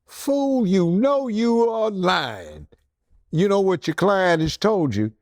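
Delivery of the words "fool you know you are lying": high, slow incredulous call-out; mock outraged scolding; exasperated scolding. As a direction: drag it out at a shameless liar. Scolding lying client.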